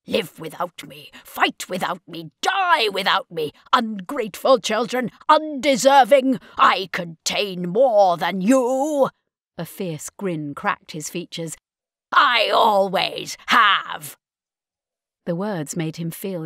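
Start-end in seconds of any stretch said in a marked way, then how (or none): 0.1-9.1 building bitter rant. Acted. 12.1-14.2 put-on slow, loud menacing old villain; slow grim triumph.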